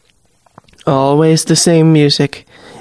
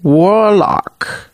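Warlock repeated higher with a scornful falling tune; mocking; 5 semitones higher than usual. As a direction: twist the word Warlock with contempt. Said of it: "The Hag Queen's lips twist".

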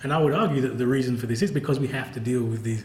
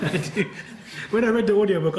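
laughing; 7 semitones higher than usual. Real, not acted. Laughing while admitting to provoking employer.

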